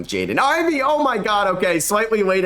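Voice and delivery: will the ball in as it flies; excited shout. Real, not acted. Gamer watching Ivey's open three, excited and tense. Nervous watching shot fly.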